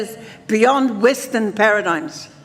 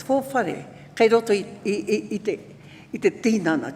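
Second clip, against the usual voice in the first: ordinary talk, nothing marked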